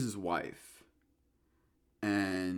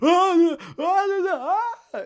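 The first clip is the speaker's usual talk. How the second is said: high loud mock scream; imitated screaming; 11 dB louder, much slower than usual. Imitating the old man as the ghost appears, mock scream. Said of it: "and he's screaming".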